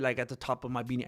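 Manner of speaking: normal talking voice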